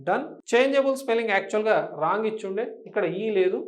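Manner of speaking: ordinary delivery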